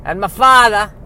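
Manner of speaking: high, loud elf voice wobbling between bad Cockney and Southern accents; a house elf sliding from a hammed-up British accent into a Southern one; bad mixed accents. House elf explaining his family to the audience, in hammed-up mixed British and Southern accents. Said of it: "my long parade of bad accents".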